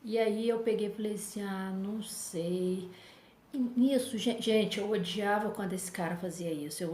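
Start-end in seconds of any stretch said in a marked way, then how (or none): 1.4-2.9 slow, drawn-out doubtful reply; reluctant, slow "não sei"; hesitant. Retold or imitated. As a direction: stall on "Ah, não sei" as if unsure. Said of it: "Eu fiquei muito em dúvida".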